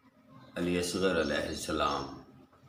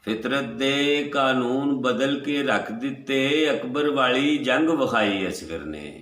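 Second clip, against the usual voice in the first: higher, chant-like couplet; melodic verse recital; 7 semitones higher, 4 dB louder than usual. Acted. Poet repeating the couplet to the gathering, high chanted recital. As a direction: let the verse ring out in one sweep.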